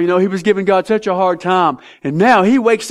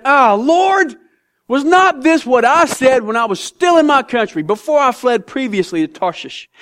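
sarcastic Jonah; 6 semitones higher than usual. Acted. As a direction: pray as if God's grace is a complaint. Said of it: "you can hear the sarcasm".

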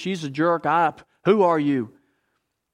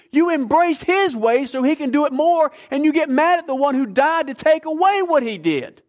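raised, higher, louder pulpit voice, pressing every phrase; preaching at full force; 7 semitones higher, 3 dB louder than usual. Acted. Preacher urging the congregation to blame Satan, at full force. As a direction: drive the point home like a fiery preacher.

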